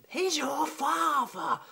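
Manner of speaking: imitating the loud neighbour mum, high and drawn out; mum shouting. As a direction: bellow it like the shrieking mum next door. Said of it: "Shouting, going".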